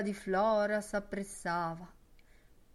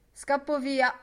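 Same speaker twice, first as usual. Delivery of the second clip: loud, raised, impatient announcement; 7 semitones higher, 8 dB louder than usual. A narrator's reading. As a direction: blurt it loudly, itching to leave. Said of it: "disse Boylente d'impazienza". (voce alta e impaziente)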